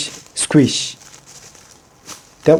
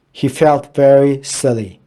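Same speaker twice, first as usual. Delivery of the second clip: usual speaking voice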